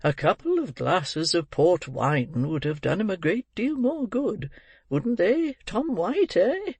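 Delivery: put-on old gentleman, pitch swooping up high; jovial old gentleman. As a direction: coax agreement with rising tag questions. Narrator voices Mister Brownlow.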